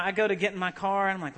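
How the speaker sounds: usual speaking voice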